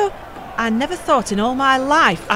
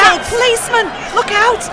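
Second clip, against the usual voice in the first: alarmed shout; 12 semitones higher, 3 dB louder than usual. Acted. Police attack a miner.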